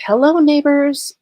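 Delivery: high, bright mock hello to the neighbors; mock cheery greeting. Mocking the suspect's cheery hello to the neighbors, sing-song. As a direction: lilt it like a carefree wave over the fence. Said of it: "And she starts giggling".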